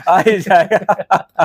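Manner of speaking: laughing. Real, not acted. Host laughing along with the shop owner's Goa joke. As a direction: giggle out the punchline.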